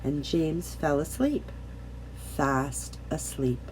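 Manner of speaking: soft and slowing to sleep; fading softly as James sleeps; hushed storybook lullaby. A narrator's reading. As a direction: let the voice drift off, slow and soft. Lulling the ending.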